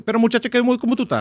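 high, singsong Dominican Spanish; Dominican accent. Shown as a demonstration. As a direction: sing the question out like a Dominican. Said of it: "And the Dominican be".

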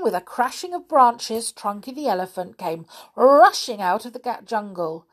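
usual speaking voice

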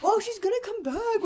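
high mock teen boy taunt; hammed-up kids teasing. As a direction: jeer like a middle schooler. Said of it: "boys are all like".

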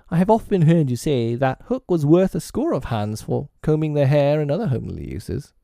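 high-pitched simple bosun voice; Smee. A narrator's reading. Smee reminds Hook of his words, piping. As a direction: pipe it up, high and earnest.

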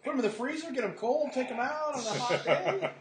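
high, giddy praise; excited gushing. Real, not acted. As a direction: rave about a treat. Gushing over frozen Crustables.

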